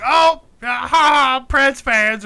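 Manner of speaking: high, loud taunting fan voice; mocking laugh. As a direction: sneer it with a fake laugh. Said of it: "She's like".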